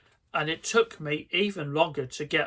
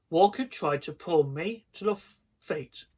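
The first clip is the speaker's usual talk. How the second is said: ordinary delivery, nothing marked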